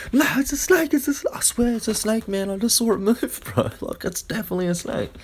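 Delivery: the scared man argues with his laughing mate; panicking insistence. Retold or imitated. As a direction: insist desperately that the snake is real.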